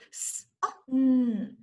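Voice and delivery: "S", "oh", "mm" as separate phonemes, "S" a voiceless hiss; segmented sounds. Shown as a demonstration. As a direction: say each sound of the word apart. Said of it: "Can you blend it?".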